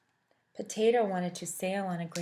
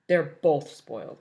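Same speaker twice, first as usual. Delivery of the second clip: low, louder, slow sneer; Waffle's voice; 4 semitones lower, 7 dB louder than usual. Acted. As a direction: sneer it knowingly. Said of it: "Waffle said to Cupcake".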